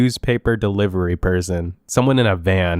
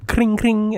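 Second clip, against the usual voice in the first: high, ringing bell noise; trilled "kring" bell imitation; imitated bike bell; 12 semitones higher than usual. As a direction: mimic a little bicycle bell, pitched way up.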